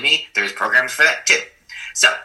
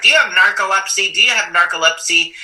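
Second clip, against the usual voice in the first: high, loud mock disbelief; incredulous question; 10 semitones higher, 5 dB louder than usual. Friends asking if he has narcolepsy, loud and incredulous. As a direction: repeat it like a baffled friend. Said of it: "And everybody's like".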